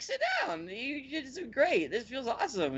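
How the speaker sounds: put-on very high, blissed-out girl voice; girl in a massage chair praising her friend, high and blissful; mock girl voice. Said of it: "she's like".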